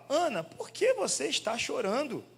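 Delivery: slow, high, gentle husband asking why she weeps; tender questioning. Retold or imitated. Husband Elkanah gently asking Ana why she cries, slow and high. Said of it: "seu marido, lhe perguntava".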